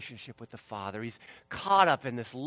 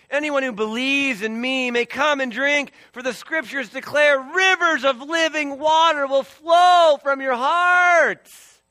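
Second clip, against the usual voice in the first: high, booming shout to a crowd; full-voiced preacher's shout, slowing into a long finish; impassioned proclamation; 10 semitones higher, 10 dB louder, slower than usual. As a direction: cry it out, stretching the final words. Preacher voices Jesus crying out.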